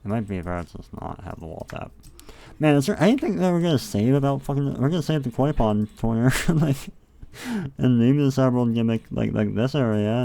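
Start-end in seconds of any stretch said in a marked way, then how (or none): 2.6-10.2 louder, higher and eager; bursting with excitement over the plan; excited. Real, not acted. Streamer telling viewers the plan, excitedly louder.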